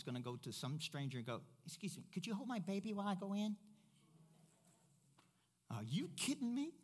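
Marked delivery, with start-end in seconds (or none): 1.7-3.6 quick, high, put-on polite request; mother asking a stranger. Mama to a stranger at the shopping center, put-on asking voice. 5.7-6.7 high-pitched incredulous question; mock disbelief. Pastor scoffing at handing a baby to a stranger, mock disbelief.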